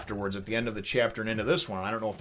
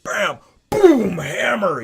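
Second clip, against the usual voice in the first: slow loud impact; 10 semitones higher, 12 dB louder, much slower than usual. Retold or imitated. Narrating punches.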